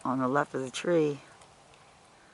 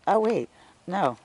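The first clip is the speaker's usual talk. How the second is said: startled; 8 semitones higher, 11 dB louder than usual. Real, not acted. Sudden surprise at squash.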